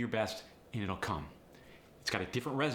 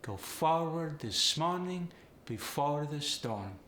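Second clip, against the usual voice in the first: slow, higher Polish accent, long O's; emphasize each long O, Polish style; 5 semitones higher, slower than usual. Shown as a demonstration. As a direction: Polish accent stretching every OR. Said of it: "gonna emphasize the long O".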